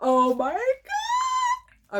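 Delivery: high, slow, drawn-out squeal; excited squeal. Real, not acted. Fan seeing her favorite actor, high squeal. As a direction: squeal it out in disbelief. Reacting to the reveal.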